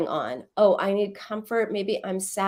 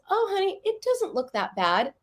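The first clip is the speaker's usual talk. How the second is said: put-on higher, breezy brush-off to a crying child; dismissive mom; 10 semitones higher than usual. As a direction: shrug off the scraped knee lightly. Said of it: "Totally dismissive".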